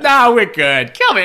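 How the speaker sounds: loud, high mock-Rosie holler; boisterous making-up. Rosie making up after a blind rage, boisterous shout. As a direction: call out big and loud, all forgiven.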